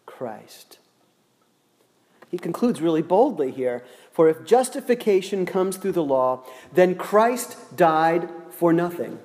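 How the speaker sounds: normal talking voice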